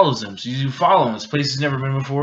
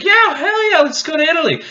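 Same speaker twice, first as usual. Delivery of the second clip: much higher, louder, giddy; squealing excited girl; 8 dB louder than usual. Retold or imitated. Girl voiced, excited reply.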